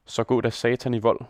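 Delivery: usual speaking voice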